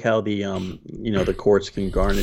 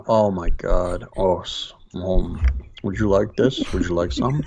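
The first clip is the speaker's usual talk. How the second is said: savoring food. Pretend. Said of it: "as if I'm eating something delicious".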